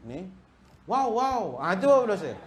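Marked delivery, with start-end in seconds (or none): 0.9-1.4 high, loud, drawn-out leering wow wow; double ogling wow. Retold or imitated. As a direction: gawk twice with relish. Double wow, second look. (wow wow gatal, tinggi dan kuat)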